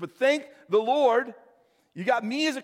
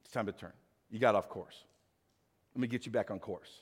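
much lower, soft and gentle; gentle Holy Spirit voice; 11 semitones lower, 9 dB quieter than usual. Retold or imitated. Holy Spirit nudging the listener back on course, gentle and low. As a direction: coax them back, tender and low. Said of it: "and he's like".

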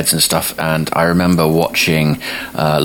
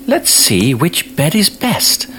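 put-on high, slow little-girl voice; high-pitched storybook girl, taking her time; Goldilocks speaking; 11 semitones higher, slower than usual. A narrator's reading. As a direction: ponder it in a high girlish voice. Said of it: "she says".

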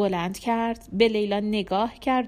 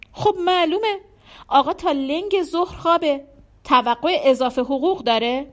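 higher, louder scornful mother; scornful disapproval; 5 semitones higher, 5 dB louder than usual. A narrator's reading. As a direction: scoff at him in indignant questions. Mother scorns jobless son-in-law.

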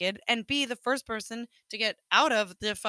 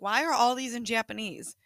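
ordinary delivery

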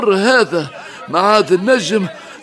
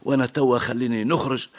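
tender reassuring; 7 semitones lower, 6 dB quieter, faster than usual. Acted. Father softly comforting daughter.

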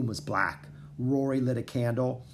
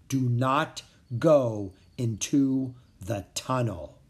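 slow mysterious warning; slower than usual. Acted. Dragon speaks inside his head.

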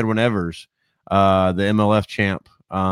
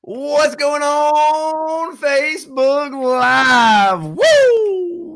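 hyped greeting; 3 dB louder, much slower than usual. Real, not acted. Show opener, hyped greeting.

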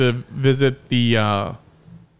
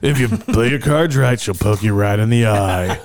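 strip club DJ voice; 4 semitones lower than usual. Pretend. Continues DJ announcer bit.

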